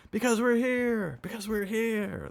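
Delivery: put-on very high, slow drunken chant; howling. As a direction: howl it out like a drunk chorus. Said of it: "they howled in unison".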